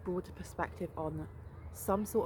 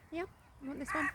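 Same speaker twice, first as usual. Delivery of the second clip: squeaky voice; 9 semitones higher, faster than usual. Real, not acted. Coaxing squirrel with nut.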